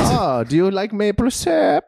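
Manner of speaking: high, slow mock German accent; camp German accent. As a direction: draw out a hammed-up German accent.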